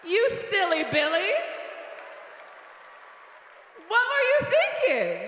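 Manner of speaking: breezy forgiving friend. Retold or imitated. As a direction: brush off the cheating like it's cute. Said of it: "Just someone who's like".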